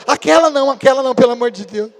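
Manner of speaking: high, loud, hurried plea; mock pleading. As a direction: beg in exaggerated alarm as the child. Filho recusa a mãe antiga. (súplica alarmada, imitada)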